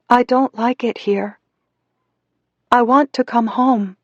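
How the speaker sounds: put-on high, homesick little girl; sad childish voice. A narrator's reading. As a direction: plead as a small child who wants out. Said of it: "Her childish voice through the speaker sounded so sad".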